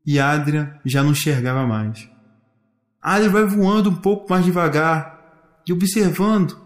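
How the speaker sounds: ordinary delivery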